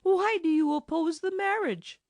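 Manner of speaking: high pleading question; high girlish voice. Acted. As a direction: ask it in a high, young voice. Heroine questions the enchanter.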